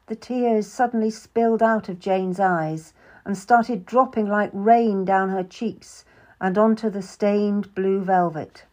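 plain reading voice

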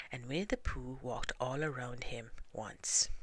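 ordinary delivery

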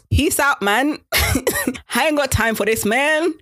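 high-pitched, drawn-out mock brush-off; mock dismissive send-off. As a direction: imitate her breezily walking off, amused. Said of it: "And Issa was like".

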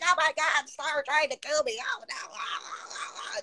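imitating a scared friend; high mock alarm, fading; mock panic. Voicing friend's panicked reaction.

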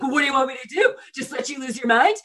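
high, loud imitation of a protesting husband; mock his exasperated comeback. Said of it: "he's like".

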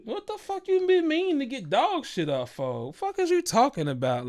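high outraged disbelief. Retold or imitated. Confronting date about dog mess.

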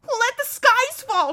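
high, loud, drawn-out cry; shrieking. Acted. Prince defying the lion's doom, shrieking. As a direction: shriek it slowly in defiance. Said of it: "shrieked Rabidash".

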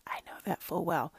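barely voiced whisper. Son still shy, whispering the verse at first. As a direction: breathe the line, hushed and hesitant. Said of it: "He was whispering it in the beginning".

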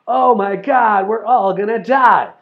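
loud, high, drawn-out cry of alarm; mock doomsday panic. Mimicking alarmist conspiracy theorists, mock panic. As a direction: wail it like the end of the world. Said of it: "Why are conspiracy theories always".

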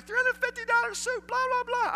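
very high, loud mocking rant; mock shrieking wife. The wife ranting at the manager, mocking shriek. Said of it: "the woman's going at it too".

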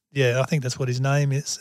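ordinary delivery

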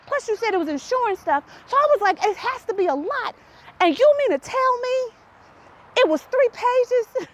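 retelling her shock it was only three pages; incredulous. Retold or imitated. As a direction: squeak out the outrage at mom. Incredulous at mother, retold.

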